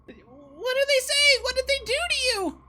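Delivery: panicked goblin voice; scared goblin. Acted. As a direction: squeak it out like a terrified little goblin.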